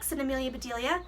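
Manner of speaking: usual speaking voice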